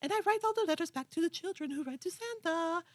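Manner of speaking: over-eager British boy, badly faked; bad British accent. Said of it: "so bad British accent".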